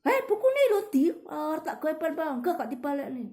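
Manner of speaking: high, loud scolding of a friend; rude snapping demo. Shown as a demonstration. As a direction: bark it at the friend like a rude kid. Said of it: "Jangan berkata".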